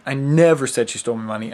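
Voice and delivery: "never" punched loud, the rest fast; stress on NEVER. Shown as a demonstration. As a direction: hit the word "never" hard. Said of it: "seven different ways".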